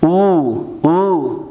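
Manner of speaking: "او" as a long German ö with rounded lips; drawn-out, isolated ö for "او"; umlaut demonstration. Shown as a demonstration. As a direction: model "او" as ö, rising then falling. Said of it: "من یک بار میخوانم شما متوجه باشین".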